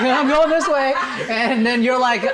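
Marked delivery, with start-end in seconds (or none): 0.0-0.9 higher, louder, laughing mimicry; imitating Hudson. Coach imitates Hudson leaving the awkward scene. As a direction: play him brushing it off. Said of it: "Hudson's like".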